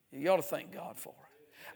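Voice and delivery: ordinary talk, nothing marked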